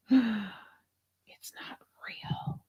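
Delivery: slow, stunned whisper; very slow whisper of dismay; shocked whisper. Real, not acted. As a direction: breathe it out in disbelief. Shocked by fake silver.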